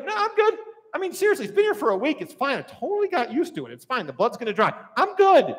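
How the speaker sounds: high-pitched, dismissive doctor brushing it off; breezy dismissal. Acted. Acted doctor dismissing a glaring injury.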